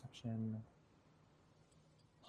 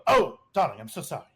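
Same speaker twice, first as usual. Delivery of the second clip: uppity British apology, high opening dropping away; posh, uppity British voice; uppity British accent; 4 semitones higher, 5 dB louder, faster than usual. Acted. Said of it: "in a very uppity British accent".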